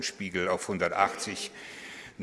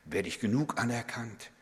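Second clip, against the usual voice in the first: much softer, anxious asking; insecure self-questioning; 6 dB quieter than usual. Pretend. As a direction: murmur the vain worries. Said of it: "um sich selber dreht". (leise, ängstlich fragend)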